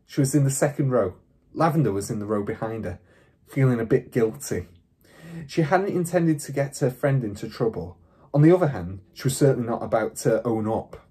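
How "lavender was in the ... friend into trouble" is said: ordinary delivery, nothing marked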